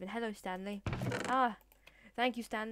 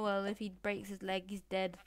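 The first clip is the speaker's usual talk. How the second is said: ordinary talk, nothing marked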